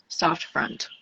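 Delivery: a soft demo whisper of "soft front". Shown as a demonstration. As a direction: whisper "soft front" gently, letting the words go breathless. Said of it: "another thing I learned ... soft back".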